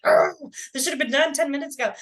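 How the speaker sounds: quick, exasperated muttering at a meeting dragging on; impatient inner voice. Retold or imitated. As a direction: rattle it off fast like you've lost patience. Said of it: "and you're like".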